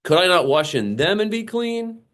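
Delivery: high, scornful outrage; indignant proud question. Acted. Naaman scoffing at being told to wash in the Jordan, high and indignant. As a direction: sneer it like an offended general. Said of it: "He's in his pride".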